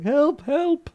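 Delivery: high, muffled cry for help. Retold or imitated. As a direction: call for help as if from inside a snake. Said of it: "a muffled voice like".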